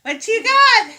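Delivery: high, loud, thrilled reveal; excited reveal. Real, not acted. Mom revealing the surprise gift to the girls, excited. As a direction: burst out with delight.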